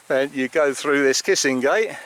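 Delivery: ordinary talk, nothing marked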